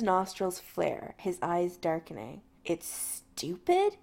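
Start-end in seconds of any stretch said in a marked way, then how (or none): 2.6-4.0 icy, slow, higher question; cold fury. Acted. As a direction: say it icily. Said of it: "he says icily".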